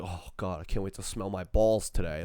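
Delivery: low, soft pretend yearning; mock longing. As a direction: sigh it as sarcastic eager anticipation. Mocks imagined eager ball-sniffer.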